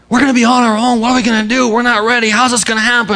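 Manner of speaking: high, anxious panic, voice jumping up; panicked disciples fretting aloud; freaking out. Retold or imitated. Disciples panicking as Jesus says he's leaving, high and anxious. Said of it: "And they freaked out".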